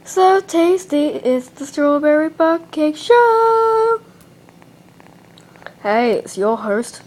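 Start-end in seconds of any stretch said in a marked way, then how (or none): 0.0-4.0 show intro announcer. Acted. Host opening the Strawberry Butt Cake Show, hammed-up announcer.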